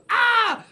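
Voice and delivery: very high, loud mock scream; angry yell. Kid told to get angry, loud mock angry yell. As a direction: burst out in a piercing imitated yell. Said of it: "doing his angry yell".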